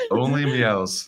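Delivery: drawn-out, high, loud laughing repeat; helpless amusement at a silly name. Real, not acted. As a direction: crack up while stretching the words. Cracks up at the joke.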